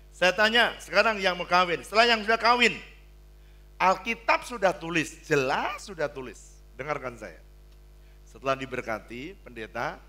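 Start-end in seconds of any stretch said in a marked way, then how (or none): 0.1-6.6 loud, higher pulpit voice; preaching at full force. Acted. Preacher pressing the married couples, loud and forceful. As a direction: hammer the point home.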